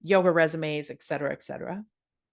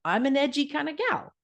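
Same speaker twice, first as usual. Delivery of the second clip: wry self-mockery; 9 semitones higher than usual. Real, not acted. Ironic self-description, pitch jump.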